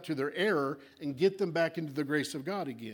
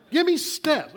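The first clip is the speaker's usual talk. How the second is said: demanding steps; 10 semitones higher, 10 dB louder, slower than usual. Pretend.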